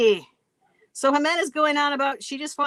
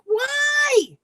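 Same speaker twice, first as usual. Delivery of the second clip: mock shout; 11 semitones higher than usual. Amanda reacting, retold.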